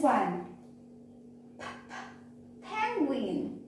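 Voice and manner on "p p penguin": two breathed p puffs, then "penguin"; isolated p sound. Shown as a demonstration. Teacher showing children the first sound, isolated p. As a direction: sound out the p twice before "penguin". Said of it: "all of these has p sound".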